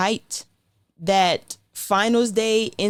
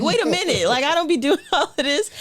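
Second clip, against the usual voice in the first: high-pitched disbelief; 8 semitones higher than usual. Retold or imitated. Retelling her reaction, Cheetos.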